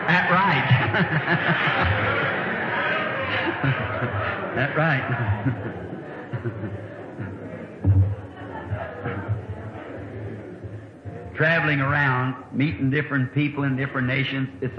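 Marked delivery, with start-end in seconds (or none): 0.7-4.5 amused laughter; breathy laugh. Real, not acted. Preacher laughs at his story. 5.1-11.3 low quiet laughter fading; amused laughter; long soft laugh. Real, not acted. Preacher laughs at his story.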